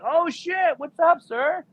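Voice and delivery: very high, squeaky-pitched thrilled greeting; high-pitched mock excitement; excited greeting. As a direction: imitate an amazed recruit, way up high. Recruit greets him, amazed.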